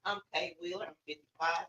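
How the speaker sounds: ordinary talk, nothing marked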